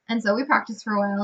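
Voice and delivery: ordinary talk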